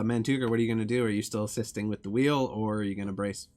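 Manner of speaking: normal talking voice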